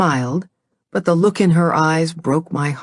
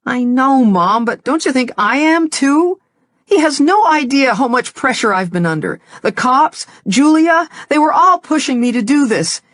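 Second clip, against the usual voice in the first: higher, louder overwhelmed daughter; upset outburst; 8 semitones higher, 3 dB louder than usual. Acted. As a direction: spill it out, near tears. Daughter venting to mother.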